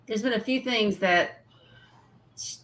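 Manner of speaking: usual speaking voice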